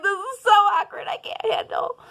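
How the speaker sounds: high whiny cringe. Retold or imitated. Herself after blocking the guy, high whiny cringe. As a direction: squeak it out in cringing embarrassment.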